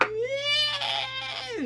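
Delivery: very high, long mock wail; crying kid. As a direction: imitate a little kid bawling. Imitates the beaten kid crying.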